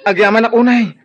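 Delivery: loud, high burst of happy thanks; overjoyed thanks. Acted. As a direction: laugh with relief as it spills out. Son overjoyed at free college. (naragsak unay a panagyaman)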